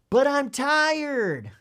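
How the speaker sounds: put-on loud, high, drawn-out child's protest. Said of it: "the child, l-, louder".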